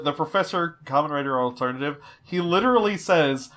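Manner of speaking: ordinary delivery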